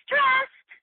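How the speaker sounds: one squeaky high, loud word; mock one-word brush-off. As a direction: fling it out as the easy answer. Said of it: "We just go".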